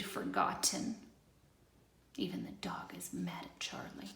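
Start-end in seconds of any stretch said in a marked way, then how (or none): 2.2-4.1 soft whispered aside. A narrator's reading. Reader whispers an aside about the dog to listeners. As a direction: lean in and whisper it.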